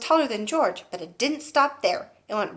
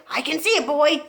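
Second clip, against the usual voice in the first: mild shout; 6 semitones higher, 4 dB louder than usual. Acted. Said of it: "the old woman shouted back".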